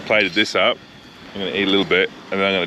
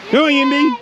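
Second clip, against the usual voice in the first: calling out; 5 dB louder than usual. Real, not acted. Calling the dog back.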